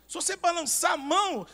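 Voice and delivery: loud preaching. Retold or imitated. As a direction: preach it out to the congregation. Retold preaching, contrast with line 2.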